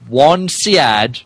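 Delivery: ordinary talk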